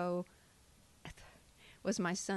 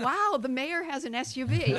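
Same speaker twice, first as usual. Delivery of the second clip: mock amazement; 8 semitones higher, 6 dB louder than usual. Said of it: "and I'm thinking".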